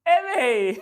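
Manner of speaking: high, loud cry mid-laughter; amused exclamation. Real, not acted. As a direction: call out, tickled by the joke. Host cracking up at a comment.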